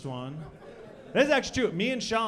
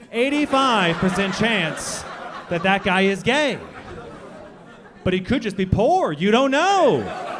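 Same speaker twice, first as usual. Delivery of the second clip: loud punchline; 8 dB louder, slower than usual. Acted. Punchline hammered loudly.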